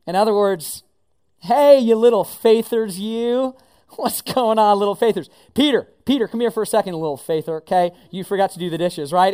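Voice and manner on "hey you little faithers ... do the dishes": loud, playful calling out to friends; teasing Jesus nickname. Retold or imitated. Jesus teasing the crowd loudly as little faithers. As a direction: tease them by their nickname. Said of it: "something more like".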